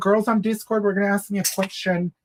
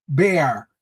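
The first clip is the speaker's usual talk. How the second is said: "Bare." said alone, punched and louder; emphasis on bare; 4 semitones lower, 3 dB louder than usual. Shown as a demonstration. Host shows her shoe to the tita, stressing "Bare.". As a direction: lean hard on "Bare." as a one-word verdict. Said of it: "Emphasis on the bare".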